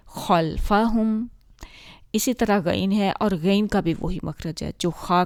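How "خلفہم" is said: خلفہم drawn out very slowly, heavy خ; slow recitation. Shown as a demonstration. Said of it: "practice کرتے ہیں". (خلفہم آہستہ تجوید کے ساتھ)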